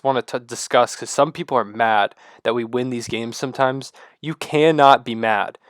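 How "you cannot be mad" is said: ordinary talk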